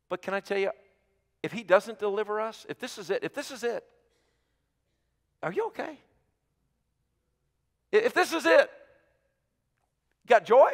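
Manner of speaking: high, pleading preacher voice; impassioned preaching. Acted. Preaching build, high pitch.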